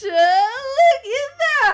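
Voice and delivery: high, slow delighted squeal; excited squeal. Real, not acted. First extension finally attached.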